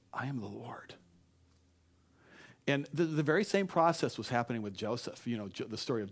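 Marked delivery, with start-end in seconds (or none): 0.1-1.0 soft, near-whispered declaration; hushed refrain. Acted. Every obstacle takes us back to this, hushed refrain. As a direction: bring it right down to a hush.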